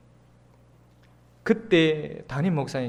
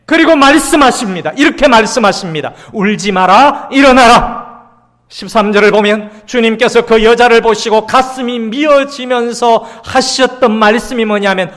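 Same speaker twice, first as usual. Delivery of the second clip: loud, high preaching voice; full-force preaching; 7 semitones higher, 15 dB louder than usual. Acted. Pastor preaching to the congregation, loud and fervent.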